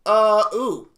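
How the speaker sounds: normal talking voice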